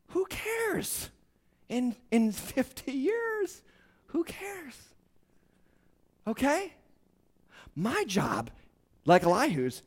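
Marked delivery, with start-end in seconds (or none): none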